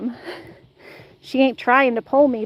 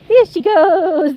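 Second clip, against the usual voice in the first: excited cheer; 3 semitones higher, 4 dB louder, much slower than usual. Real, not acted. Cheering dog running.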